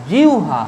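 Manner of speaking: জিহ্বা said as jiu-ha; correct pronunciation demo. Shown as a demonstration. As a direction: glide from i to u in জিহ্বা, then ha. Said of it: "তাহলে কি লিখবো আমরা". (জিহ্বা-র উচ্চারণ "জিউহা")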